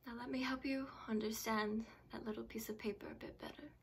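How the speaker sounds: quiet, controlled recollection; hushed voice with long pauses; bitter grieving patient. Acted. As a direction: explain softly to the doctor, holding grief in. Patient explains the loss to doctor.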